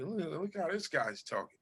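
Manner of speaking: lightly voiced curious onlooker; mimicking a funny talker. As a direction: imitate someone sizing up a stranger. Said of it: "always try to mimic people".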